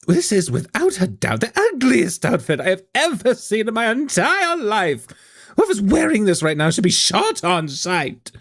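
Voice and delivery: high-pitched, scandalized fashion critic; trash-talk the outfit in a high, theatrical host voice; high and withering, playing to the audience; catty talk-show host.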